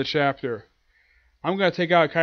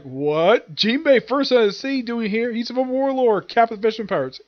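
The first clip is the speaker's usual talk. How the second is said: higher, mildly shocked voice; shocked crowd; 6 semitones higher than usual. Retold or imitated. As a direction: voice the crew's disbelief at Jinbei. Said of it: "everyone's like".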